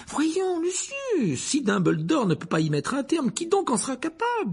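sweating minister begging; flustered Fudge. A narrator's reading. Fudge pleads with Malfoy, sweating and nervous.

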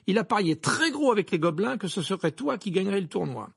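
a touch of animated grievance; aggrieved twin. Acted. Twin complaining to friends about an unpaid bet, lightly voiced indignation.

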